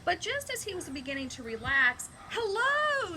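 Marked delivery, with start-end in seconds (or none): 2.3-3.2 much higher, louder hello from the tortoise; shouted. Acted. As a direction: shout a greeting, pitched up high. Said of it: "shouted a tortoise".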